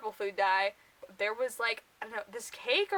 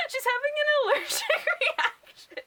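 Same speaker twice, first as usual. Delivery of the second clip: much higher, loud words through laughter; real helpless laughter; laughing fit; 9 semitones higher, 4 dB louder than usual. Real, not acted. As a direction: squeal it out while cracking up. Laughing at allergic scene.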